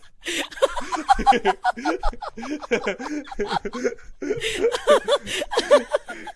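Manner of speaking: long high rising burst of laughter; real laugh breaking out after the bit. Real, not acted. Cracking up at his friend's bit, high laugh.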